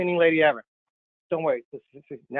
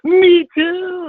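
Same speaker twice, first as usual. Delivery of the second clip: excited outburst; 11 semitones higher, 8 dB louder than usual. Real, not acted. Reacting to cleaning lady's answer.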